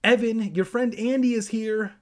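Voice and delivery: high, loud aunt voice; singing-out high-pitched call; Aunt Dee calling down. A narrator's reading. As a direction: holler down the stairs. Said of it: "Aunt Dee called down".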